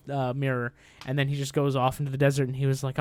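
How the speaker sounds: usual speaking voice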